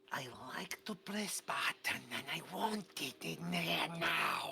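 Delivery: whispered, slow creepy voice; slimy whisper. Acted. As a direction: hiss it out like a slimy creature. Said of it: "it's the slimy voices".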